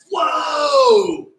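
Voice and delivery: loud, high stadium whoa; crowd roar cheer. Retold or imitated. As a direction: yell like fifty thousand fans. Fans going nuts.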